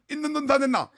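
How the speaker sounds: 있는 돈 다 냈나 with a slushy lisp, high and loud. Retold or imitated. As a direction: lisp the s sounds, laughing. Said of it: "혀 짧은 소리로".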